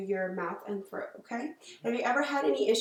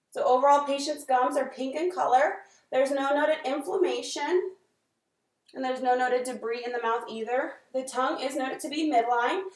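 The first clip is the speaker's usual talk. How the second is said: clinical dictation; 2 semitones higher, 4 dB louder than usual. Acted. Dictating exam findings aloud.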